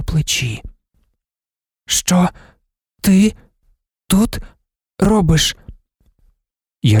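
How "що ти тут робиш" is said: high monotone, word ... by ... word; unmodulated, choppy speech. A narrator's reading. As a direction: deaf woman speaking in separate, evenly pitched words. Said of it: "Вона вимовляла немодульовано й уривчасто".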